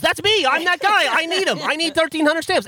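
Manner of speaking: high, emphatic mock insistence; insistent Bernie. Bernie telling the clerk he needs the stamps, high and insistent.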